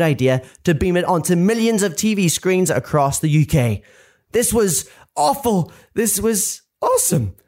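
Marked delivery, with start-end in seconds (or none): 4.3-5.7 slow, higher-pitched AWFUL, drawn out; dramatic dismay. Acted. Adam realising his video is on TV, dramatic dismay. Adam's horror, contrasted with delight. 5.9-7.4 high, thrilled leap on "awesome"; sudden delight. Acted. Adam realising he is famous, thrilled delight. Adam's dismay flips to delight.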